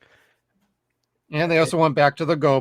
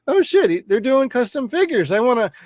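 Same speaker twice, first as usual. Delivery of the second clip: higher, excited mock reaction; mock excited discovery; 9 semitones higher, 3 dB louder than usual. Fan discovering custom figures a day late, mock excited. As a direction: blurt it out high, imitating sudden excitement.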